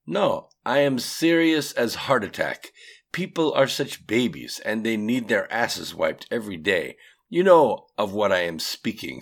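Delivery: put-on emphatic Russian-accented rant. A narrator's reading. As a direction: push it up in a thick Russian accent. Atium's accented dialogue.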